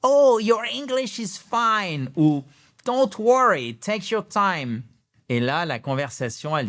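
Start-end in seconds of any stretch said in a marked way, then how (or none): 0.0-4.8 high, slow, kindly English reply; higher, slowed, gentle voice of a friendly local; friendly native speaker. Retold or imitated. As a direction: sound like a reassuring anglophone stranger. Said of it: "elle vous répond".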